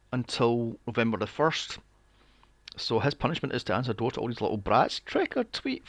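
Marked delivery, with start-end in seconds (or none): 5.0-5.9 mock kid trick-or-treater. Mocking kids trick-or-treating.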